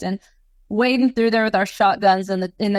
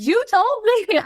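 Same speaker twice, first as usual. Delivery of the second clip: panicked accusation; 13 semitones higher, slower than usual. Retold or imitated. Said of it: "I was like".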